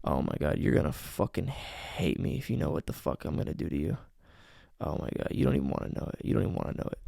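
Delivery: soft, low, teasing near-whisper; hushed teasing. Shown as a demonstration. Coach demos a teasing voice memo, soft and low. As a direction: tease her by holding it back. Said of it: "So I might say".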